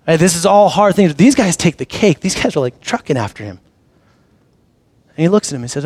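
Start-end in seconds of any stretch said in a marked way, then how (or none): none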